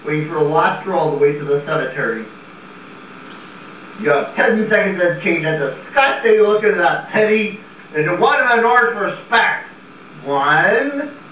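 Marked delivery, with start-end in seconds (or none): none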